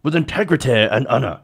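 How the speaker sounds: put-on accent for a quoted official statement; hammed-up accent, imitating a politician's formal statement; Jeff Sessions accent. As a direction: read it in a mock Attorney General accent. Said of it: "I don't know why I'm using that accent".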